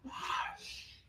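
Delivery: "Hush" whispered, sounds melting together; blended breathy hush. Shown as a demonstration. Director showing the blended whispered hush. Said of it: "try to blend them a little bit more".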